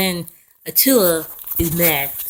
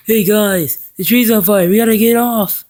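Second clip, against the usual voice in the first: loud, higher-pitched calling out; urgent warning; 5 semitones higher, 8 dB louder than usual. Retold or imitated. Napoleon warns everybody the tree's on fire, urgent shout. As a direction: shout the alarm to the whole group.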